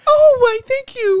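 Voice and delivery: loud, very high flattered falsetto; Miss Piggy. Acted. Puppet diva told she is welcome, high gushing thanks. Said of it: "we love her".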